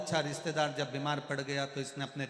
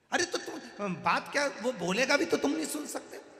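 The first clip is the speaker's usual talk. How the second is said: scolding grandson; 9 semitones higher, 5 dB louder than usual. Retold or imitated.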